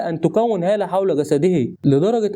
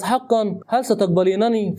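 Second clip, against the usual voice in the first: plain reading voice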